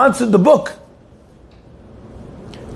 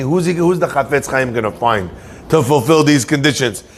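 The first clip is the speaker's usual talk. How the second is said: inner thought voice; 7 semitones lower than usual. Retold or imitated. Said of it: "like I'm thinking".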